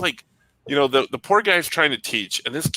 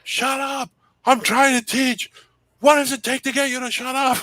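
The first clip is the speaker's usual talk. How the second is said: yelling teacher; 12 semitones higher than usual. Retold or imitated. The teacher yelling at the kid to stop talking, high mock yell. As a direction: demand silence, exasperated. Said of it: "he started yelling".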